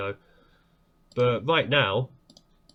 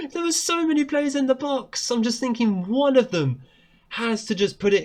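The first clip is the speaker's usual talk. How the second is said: excited relief; 10 semitones higher than usual. Real, not acted. After the goal, relieved.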